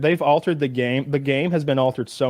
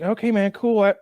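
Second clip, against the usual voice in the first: quoting himself; 8 semitones higher than usual. Retold or imitated. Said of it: "I was like".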